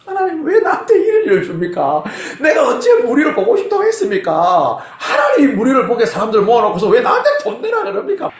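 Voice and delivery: high, shouted complaint to God; hammed-up protest. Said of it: "막 따졌단 말이에요".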